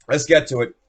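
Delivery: ordinary delivery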